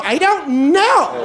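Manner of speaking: high, slow entitled whine; bitchy. Retold or imitated. To the robber, a high slow whine like an angry customer. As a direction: play the irate restaurant customer. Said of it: "Like I'm an angry lady at a restaurant".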